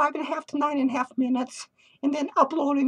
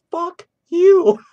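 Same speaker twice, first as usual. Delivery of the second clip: shout; 2 semitones higher, 12 dB louder than usual. Retold or imitated. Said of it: "and I said".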